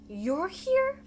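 high, loud little voice; childlike retort. A narrator's reading. Baby possum answering Bongo's warning, high childlike retort. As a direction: pipe up with a high questioning lilt.

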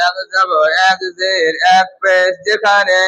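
slow, measured classroom recitation; rule recitation. Shown as a demonstration. Teacher leading a class through a reading rule, slow recitation. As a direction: chant the rule out slowly, word by word.

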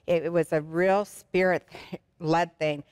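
ordinary talk, nothing marked

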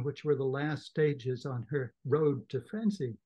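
ordinary talk, nothing marked

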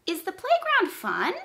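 "playground" pitched high, "fun" swooping up at the end; asked like a question. Shown as a demonstration. Teacher models a question for pupils, high rising "fun". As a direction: ask it high, with a big rise on "fun". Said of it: "If I ask it like a question".

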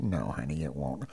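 soft, low, tender answer; gentle reassurance. Retold or imitated. Grandparent answering granddaughter's voice question, gently.

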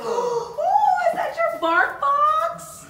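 high, loud delighted squeal; loud high excited squeal at the dog. Real, not acted. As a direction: squeal with joy at the gift.

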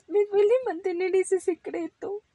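high, plaintive little rabbit voice; tearful. Acted. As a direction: whimper out the guilty feeling. Ramón tearfully admitting guilt.

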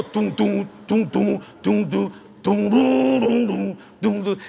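rhythmic "doom" guitar imitation; imitated guitar riff. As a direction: vocalize a steady blues riff. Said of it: "And he'd go".

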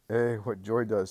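ordinary delivery

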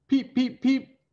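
high, squeaky little chick peeps; chick peeping. Acted. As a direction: peep like baby chicks in a story. Imitates chicks peeping in eggs.

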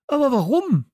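laut und hoch, fassungslos; aufgebracht. A narrator's reading. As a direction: entsetzt vorwerfen. Kalle fassungslos. (laut und fassungslos)

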